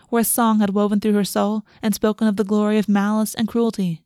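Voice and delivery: ordinary delivery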